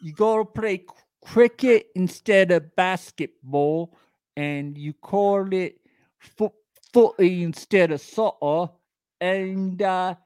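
lightly hammed-up British voice; mock British accent. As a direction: list British habits in a put-on accent.

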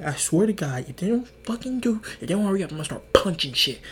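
mock-angry grumbling threat; worked-up, half-serious threat; irritated threat. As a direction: imitate a friend losing patience. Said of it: "he was so irritated".

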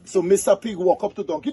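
high-pitched, animated storyteller; folk-tale storytelling. Acted. Tells a donkey folk tale.

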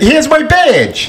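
slow, high-pitched cop announcement; movie cop challenge. Acted. Podcaster acting Seagal's bar challenge, drawn-out mock tough cop.

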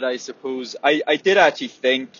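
ordinary talk, nothing marked

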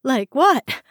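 high, loud, breathless echo; nervous panting. Acted. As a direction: pant it out nervously. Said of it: "Franklin panted nervously".

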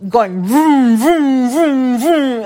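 loud vrooming engine noise; engine imitation. Woman mimicking his motorcycle ride, loud revving noises. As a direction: rev it like a motorcycle.

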